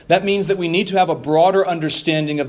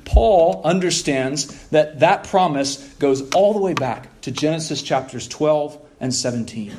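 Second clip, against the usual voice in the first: ordinary talk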